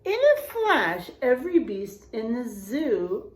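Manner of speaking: put-on lively read-aloud to children; storybook reading. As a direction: read it like a bedtime story for kids. Reading picture book aloud.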